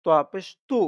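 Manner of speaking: "তু" with the lips rounded, heavier T; rounded-lip pronunciation. Shown as a demonstration. Teacher contrasting two Arabic letters for learners, "তু" said with rounded lips. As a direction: round your lips into "তোয়া পেশ তু". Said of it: "ঠোঁট গোল করে উচ্চারণটা".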